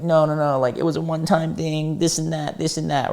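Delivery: high, soft whiny-excuse imitation; mock excuse-making. Cheater lying to himself about a one-time thing, mock excuses.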